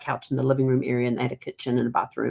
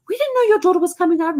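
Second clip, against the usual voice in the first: high, louder mock church friends; surprised friends; 5 dB louder, faster than usual. Friends at church exclaim in a high mock voice. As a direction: squeal it like surprised church ladies.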